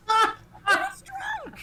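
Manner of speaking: high, loud laughing cry; laughing outburst. Real, not acted. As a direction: break up laughing on it. GM cracking up mid-sentence.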